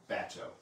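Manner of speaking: bateau said BAT-oh, stress on the first syllable; Eastern Shore bateau. Shown as a demonstration. Historian shows how Eastern Shore watermen say it, stress on the first syllable. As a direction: bateau with the weight on BAT, not the French way. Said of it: "emphasis on the first syllable".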